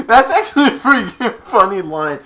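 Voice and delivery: high, loud words through real laughter; laughing while talking. Real, not acted. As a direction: talk while still cracking up. Laughs at the Grinch line.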